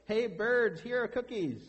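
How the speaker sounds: high, loud storybook call; loud shout. A narrator's reading. Frog outside calling the birds to the cookies, loud high shout. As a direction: call out to a flock at full read-aloud volume. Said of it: "he shouted in a loud voice".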